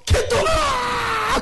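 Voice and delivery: long high shout for a lost son; calling out. Acted. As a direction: stretch the name out as a cry. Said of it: "해가 지도록". (해질녘까지 아들 이름을 길게 외침)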